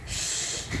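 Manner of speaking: breathy shushing whisper; a soft secret shh to keep the king quiet; hushing whisper. Acted. Elf hushing the bees' question.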